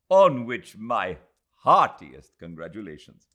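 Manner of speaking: bow and congratulate her warmly; formal congratulations. Acted. Aziz congratulating Adela, formal and loud.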